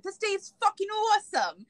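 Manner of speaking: high-pitched excited mock yell; re-enacted elated holler; retold yelling. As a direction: yell it as if fired up. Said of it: "I was yelling".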